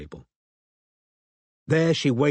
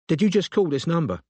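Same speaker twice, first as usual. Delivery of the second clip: high-pitched London woman's question; pitched-up, demanding London voice; London accent; 7 semitones higher than usual. A narrator's reading. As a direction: challenge the caller in a London accent. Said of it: "She had a London accent".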